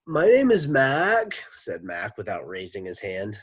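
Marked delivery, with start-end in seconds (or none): 0.1-1.4 put-on high, loud, slow booming kid; freight train voice. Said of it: "Mac's voice was like a freight train".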